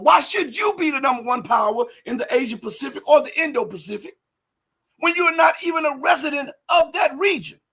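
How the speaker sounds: fiery preacher demanding an answer; indignant shout. Real, not acted. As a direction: raise the voice in outrage at America. Preaching rant, rhetorical challenge.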